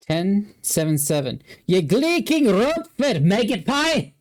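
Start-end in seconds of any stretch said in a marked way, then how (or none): 1.7-4.1 slow, high Scottish insult; Scottish accent. Acted. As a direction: bellow it in a Scottish brogue. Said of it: "with my Scottish accent there".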